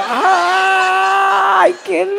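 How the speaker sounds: high, loud mock-thrilled ay; a high hammed-up squeal of joy; delighted squeal. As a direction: squeal with glee at the invitation. Delighted at being invited to watch.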